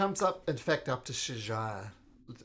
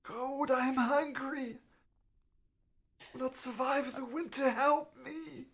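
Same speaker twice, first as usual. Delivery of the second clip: put-on high, weak old lady pleading; frail old woman; 13 semitones higher, 3 dB louder than usual.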